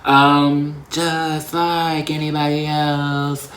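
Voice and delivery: high, loud and slow, hammed-up pride; teasing herself with an over-the-top affirmation; mock grand declaration. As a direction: stretch it out like a dramatic proclamation. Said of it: "I was about to be like".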